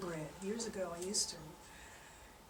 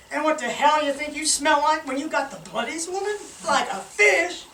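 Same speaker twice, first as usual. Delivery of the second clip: loud, raised comeback with a laugh; indignant retort; 5 semitones higher, 9 dB louder than usual. Acted. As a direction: fire back at her, half laughing. Fisherman snaps back at wife.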